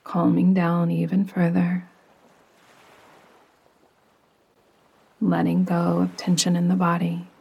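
calm, unhurried meditation voice with long pauses; soothing yoga nidra narration, evenly paced; guided meditation. Acted. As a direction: guide the listener gently down into rest.

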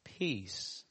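ordinary delivery

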